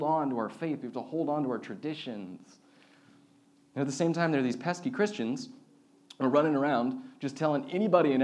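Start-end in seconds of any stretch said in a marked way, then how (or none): none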